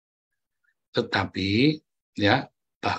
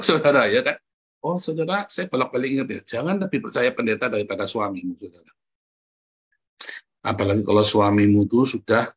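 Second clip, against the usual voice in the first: ordinary talk, nothing marked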